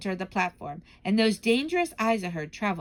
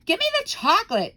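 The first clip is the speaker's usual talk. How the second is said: high, loud demand; greedy boy shouting for his chocolate; 12 semitones higher, 7 dB louder than usual. Acted. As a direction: yell it like a spoilt kid. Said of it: "shouted Bruno".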